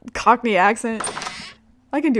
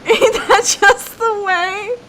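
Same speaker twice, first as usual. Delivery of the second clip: high, slow, drawn-out delivery; mock catchphrase voice; 10 semitones higher, much slower than usual. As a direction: stretch it out in a high put-on voice. Repeats catchphrase after long laughing fit.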